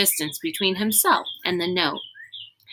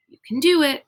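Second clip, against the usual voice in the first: higher, louder pep talk to himself; a boy bracing himself, higher and louder; urging himself on; 8 semitones higher, 5 dB louder, faster than usual. Acted. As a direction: psych yourself up before the run. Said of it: "Rainey told himself".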